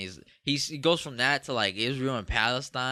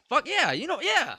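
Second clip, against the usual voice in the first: high, cocky fuck yeah; cocky boast; 13 semitones higher than usual. Real, not acted. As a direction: crow about it like you're untouchable. Cocky boast about success.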